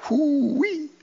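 high, soft "whoo-wee" of awe; high whoop. Real, not acted. Preacher marvelling at a consuming fire, high drawn-out whoop. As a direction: let out a long, high whoop.

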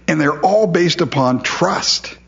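normal talking voice

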